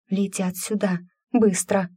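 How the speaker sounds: high, urgent character voice; narrated girl's excited call; excited announcement. A narrator's reading. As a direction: alert the others to what's coming. Lanka announces approaching griffins.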